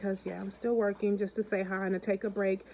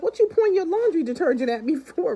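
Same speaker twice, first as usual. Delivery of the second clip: higher, louder confused stranger, laughing; mock puzzled shopper; 5 semitones higher, 6 dB louder than usual. Shopper confused by the singing man, louder and laughing. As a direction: play the weirded-out shopper. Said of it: "They looking at him like".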